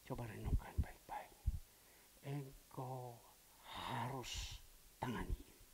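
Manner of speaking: whispered voice of God in the heart; hushed divine instruction; inner whisper. Retold or imitated. God speaking inwardly to pastor.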